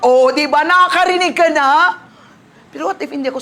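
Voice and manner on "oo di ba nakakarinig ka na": excited joy. Retold or imitated. Joyful retold exchange.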